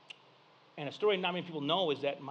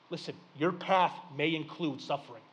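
normal talking voice

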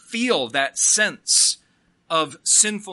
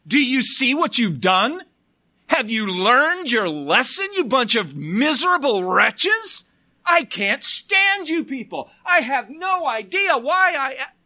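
loud, high, disgusted scolding; mock angry God; 7 semitones higher, 6 dB louder than usual. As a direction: berate them like a fed-up God. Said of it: "the message goes something like this".

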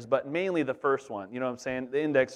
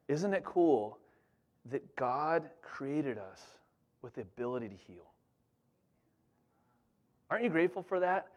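gentle; 7 dB quieter than usual. Retold or imitated. Father to son, gentle.

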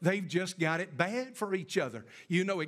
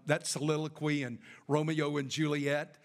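ordinary talk, nothing marked

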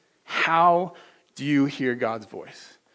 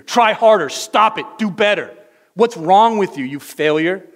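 loud, high, rapped-out commands; harsh accusing voice; 6 semitones higher, 13 dB louder than usual. Acted. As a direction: scold them as a hopeless disappointment. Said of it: "Is that the voice that you hear".

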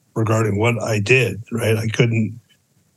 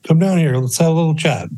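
retold calling out; 7 semitones higher, faster than usual. Garage worker to the transient uphill, retold calling out. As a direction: invite him down, mildly raised voice.

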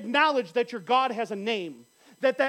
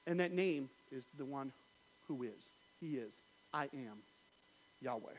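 almost breathe the name; hushed reverence; 9 semitones lower, 13 dB quieter than usual. Real, not acted. Preacher hushing as he speaks God's name.

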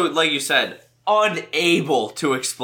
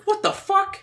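high-pitched baffled reaction; squeaky, flabbergasted exclamation; incredulous outburst; 14 semitones higher than usual. Retold or imitated. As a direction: blurt it out in mock disbelief. Acting own baffled reaction to film.

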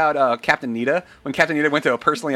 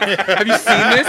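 excited; 6 semitones higher, 6 dB louder than usual. Retold or imitated. Excited quote to fellow soldiers.

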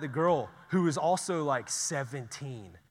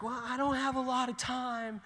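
lightly voiced lady; 10 semitones higher than usual. Voicing the hurried older lady.

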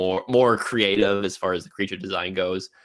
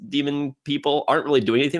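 normal talking voice

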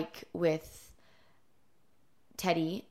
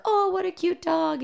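high, louder coo over a dog; passerby gushing; 12 semitones higher, 6 dB louder than usual. Retold or imitated. Passerby cooing at friends' dog on a walk, high gushing imitation. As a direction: imitate a stranger fawning over a cute dog. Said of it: "some guy passed and was like".

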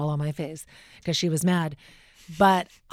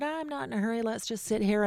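gentle reassurance; 5 semitones higher, 5 dB quieter than usual. Retold or imitated. Kind actor calms nervous interviewer.

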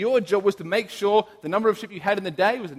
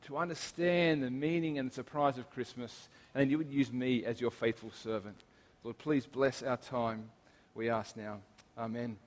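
prayer; 5 semitones lower, 10 dB quieter than usual. Acted. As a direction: pray quietly, reverently. Closing prayer before sermon.

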